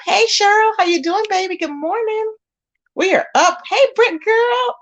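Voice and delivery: very high singsong hellos; excited greeting. Real, not acted. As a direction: squeal the welcomes up high. Greeting viewers joining live.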